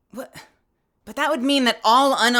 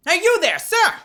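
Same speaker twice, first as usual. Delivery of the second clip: higher, louder guard calling out, a bit like Gumshoe; 7 semitones higher, 4 dB louder than usual. Acted. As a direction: hail the stranger like Detective Gumshoe. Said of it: "That's kind of Gumshoe".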